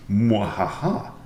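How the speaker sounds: slow mock evil laugh; hammed-up villain cackle; sneering panto villain. Gloating over threatening mate.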